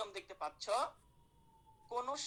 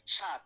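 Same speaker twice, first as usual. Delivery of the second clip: ষাঁড় called out loud and punchy; loud model word; 3 semitones lower, 8 dB louder than usual. Shown as a demonstration. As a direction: bark the word ষাঁড় for the class to copy. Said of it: "কি বলা হচ্ছে?". (শিক্ষকের মতো জোরে ষাঁড় বলা)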